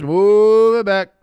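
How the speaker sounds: slow, loud, booming referee announcement; mock referee call. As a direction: draw it out like an official on the field mic. Mock referee ordering the penalty.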